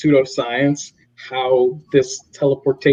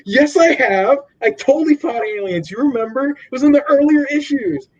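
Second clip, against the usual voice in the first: much higher, hammed-up protest; defensive Mark; 10 semitones higher than usual. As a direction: insist like a caught-out boaster.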